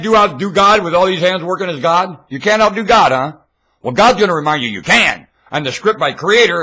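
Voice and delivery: high, loud preaching at full force; fiery preaching. Real, not acted. Preacher railing at his listeners, fiery sermon. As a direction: hammer the crowd with it.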